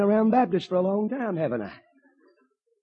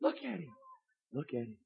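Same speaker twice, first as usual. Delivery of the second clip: softer and softer; fading into hushed wonder; awed hush; 8 dB quieter than usual. Acted.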